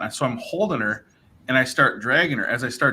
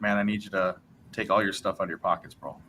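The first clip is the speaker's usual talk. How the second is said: softer, lower, confidential warning; quietly tipping him off, voice dropped; hushed cop aside; 4 semitones lower, 6 dB quieter than usual. Retold or imitated. Friendly cop warning him aside, softly hushed.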